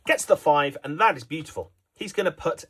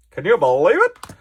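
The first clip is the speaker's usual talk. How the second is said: excited commentator; 6 dB louder than usual. Acted.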